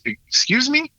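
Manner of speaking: high-pitched, taken-aback query; put-on puzzled "pardon?" voice; incredulous guard. Guard's incredulous reply, acted.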